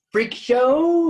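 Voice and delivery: high, loud teasing squeal. Real, not acted. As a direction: squeak it out as a joke. Teasing question about circus job.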